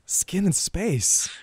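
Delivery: milk each word like a grand art concept; teasingly pompous slow repeat; mock profound. Repeats the art phrase for laughs.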